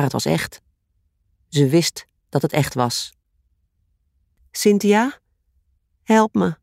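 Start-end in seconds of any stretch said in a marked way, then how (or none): 4.5-6.6 put-on higher little girl calling for help; child's voice calling. A narrator's reading. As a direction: call out like a frightened child. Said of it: "de stem van een kind dat in de nacht riep".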